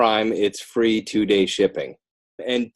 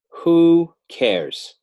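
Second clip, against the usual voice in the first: high, loud rhetorical who cares; emphatic who cares; 10 semitones higher, 6 dB louder than usual. Real, not acted. Author pressing his message on the host: emphatic. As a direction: hammer home the question with a raised voice. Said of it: "author preaching his book's message".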